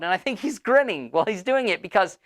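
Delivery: usual speaking voice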